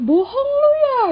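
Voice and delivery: high, loud, unbelieving; disbelieving. Retold or imitated. Friend on WhatsApp refusing to believe her, high and loud. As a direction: squeal the disbelief. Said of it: "Terus dia bilang".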